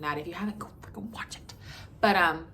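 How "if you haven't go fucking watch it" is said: unvoiced cheeky whisper; whispered aside. Real, not acted. Telling viewers to watch the last video, whispered. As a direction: drop to a whisper for the swear.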